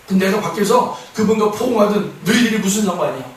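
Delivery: ordinary talk, nothing marked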